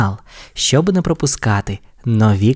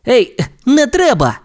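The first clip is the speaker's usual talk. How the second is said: much higher, mock protest; protesting yell; 13 semitones higher than usual. Race car protests the scalpel, mock yell. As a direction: yell to stop the surgery. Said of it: "загорлав Блискавка".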